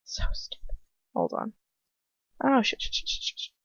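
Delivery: unvoiced, hushed swearing; whispered panic. Real, not acted. Startled by something, she whispers curses to herself. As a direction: hiss the curses under breath.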